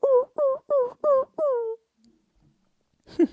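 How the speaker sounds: very high yelping ow cries; imitating a hurt animal. Mimicking a hurt roadside animal, high yelps. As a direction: yelp like an injured animal by the road. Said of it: "My cat looked at me 'cause of that noise".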